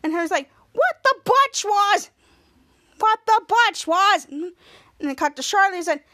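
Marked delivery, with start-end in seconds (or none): 0.7-4.3 high, slow, punched-out protest; outraged exclamation. Retold or imitated. Henry blasted in the eye, slow high outrage. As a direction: stretch each word in mock outrage.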